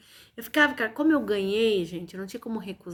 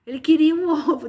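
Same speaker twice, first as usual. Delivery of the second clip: louder, higher and fast, words broken by a laugh; 5 semitones higher, 8 dB louder, faster than usual. Real, not acted. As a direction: laugh through it, amused by the dog. Laughing at the egg story. (rindo de verdade, mais alto e rápido)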